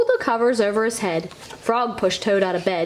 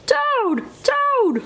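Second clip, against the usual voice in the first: much higher, urgent calling out; cried out; 13 semitones higher than usual. A narrator's reading. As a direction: cry his friend's name up high. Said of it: "cried Frog".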